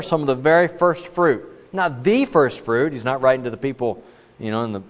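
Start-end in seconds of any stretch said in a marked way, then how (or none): 1.7-2.9 slow, with "the" said as "thee"; stress on THE. Real, not acted. Preacher clarifying who James writes to, stress on "the". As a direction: punch "the" as a long "thee". Corrects himself, stressing the article.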